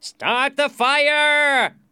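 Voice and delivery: very high, drawn-out yell; calling out. A narrator's reading. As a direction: yell the order across a crowd. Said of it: "a voice called out".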